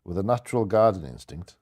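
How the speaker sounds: usual speaking voice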